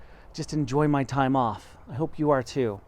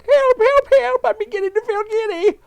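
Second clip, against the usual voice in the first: very high, much louder frantic shriek; wife screaming, feeling giddy; screaming for help; 9 dB louder than usual. Acted. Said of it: "screamed Miss Twit".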